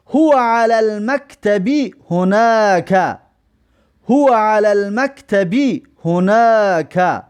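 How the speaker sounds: very slow Arabic, هناک drawn out; teacher's slow Arabic dictation; slow model reading. Shown as a demonstration. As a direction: read it twice, slowly, for the class. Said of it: "ببینید".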